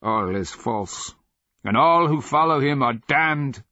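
fierce slave's condemnation; damning denunciation. A narrator's reading. Slave damns the followers.

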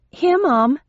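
high, prompt, respectful answer; respectful young sister. A narrator's reading. Bennett sister answering when called, high and respectful. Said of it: "bobbing respectfully".

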